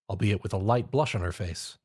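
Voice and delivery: ordinary delivery, nothing marked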